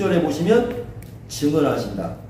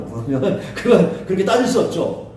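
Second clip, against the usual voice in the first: ordinary delivery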